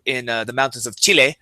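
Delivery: show-off traveler with overdone Spanish place names; English sentence, hammed-up Spanish words; over-pronounced Spanish. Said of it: "over Spanish pronunciate everything they say".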